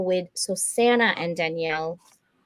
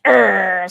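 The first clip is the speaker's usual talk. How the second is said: loud bulldog growl imitation; short, loud mock growl; dog growl; 9 dB louder than usual. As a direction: growl like a bulldog demanding dinner. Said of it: "She's growling".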